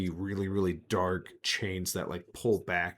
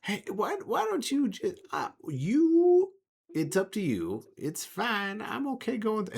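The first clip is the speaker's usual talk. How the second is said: much higher, hesitant stammering voice; Mock's voice; 13 semitones higher than usual. Mock offering Obsidian the door, high and stammering. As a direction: stammer it out, pitched way up. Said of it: "Mock looks at Obsidian and goes".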